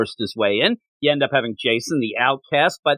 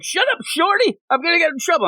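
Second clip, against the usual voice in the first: put-on bratty kid voice; 13 semitones higher than usual. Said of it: "And then you're like".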